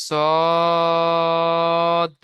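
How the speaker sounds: সদ with rounded lips, drawn very long; rounded long sad. Shown as a demonstration. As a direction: round the mouth and stretch সদ. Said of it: "মুখ গোল করে তিন আলিফ টান দিতে হবে". (মুখ গোল করে লম্বা টেনে সদ)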